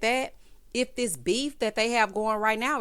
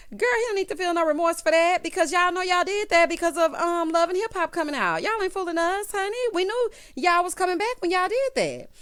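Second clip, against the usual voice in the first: raised, louder, calling them out; fired-up callout; 7 semitones higher, 5 dB louder than usual. Real, not acted. Vlogger calling out the couple, fired up. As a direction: call it out loud and high, half laughing.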